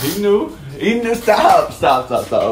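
high, loud laughing protests; being tickled. Real, not acted. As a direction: squeal it while tickled.

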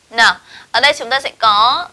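normal talking voice

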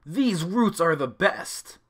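mild hollered praise. A narrator's reading. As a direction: call across the cafe with delight. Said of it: "Rachel looked up and hollered".

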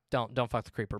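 usual speaking voice

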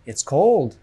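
"cold" carries the stress; teacher's model sentence with stress on "cold". Shown as a demonstration. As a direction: hit "cold", keeping "It's" light. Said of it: "The stress is on cold".

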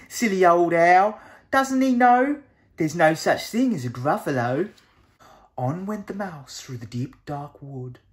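usual speaking voice

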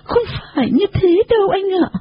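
high, plaintive little Nhà Trò voice; tearful protest. Acted. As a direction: object meekly, pitched high and close to tears. Tearful girl answers the hero. (giọng cao, yếu ớt than thở)

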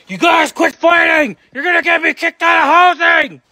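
loud, high yelling at the dogs; furious shout to break up a fight; angry shout. Acted. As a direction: scream at them to stop.

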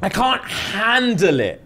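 high, slow, stretched-out words; dramatic, emotional drawl; overwhelmed. Real, not acted. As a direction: draw it out as if it's too much. Overcome by the show.

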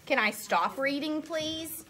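ordinary delivery, nothing marked

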